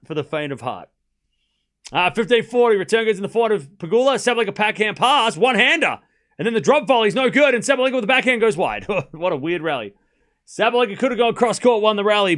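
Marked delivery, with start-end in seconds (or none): 1.9-12.4 loud, high commentator call; tennis play-by-play. Acted. Streamer commentating a smash for chat, loud and high.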